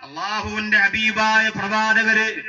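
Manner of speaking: intoned preaching with long-held line endings; impassioned religious storytelling; full-force sermon. Real, not acted. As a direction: preach it in a sustained, ringing chant. Preaches and exhorts the congregation.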